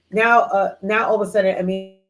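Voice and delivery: ordinary talk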